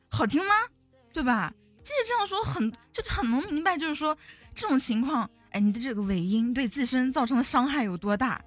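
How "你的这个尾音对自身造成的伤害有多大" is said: usual speaking voice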